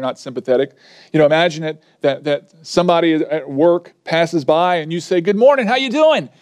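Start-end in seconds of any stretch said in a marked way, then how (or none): 5.2-6.3 bright, higher-pitched mock hello; cheery greeting. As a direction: greet a coworker in passing, pitched up. Acted cheery greeting to coworker.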